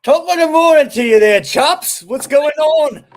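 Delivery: hammed-up British accent, loud and high; British impression. English paper boy greets the podcast hosts, hammed-up British accent. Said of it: "that was a good British impression".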